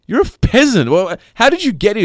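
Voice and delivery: high-pitched, incredulous retold quote; imitated disbelief. Dad to the old friend who turns up in New York, incredulous. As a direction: act out being baffled, pitch jumping up.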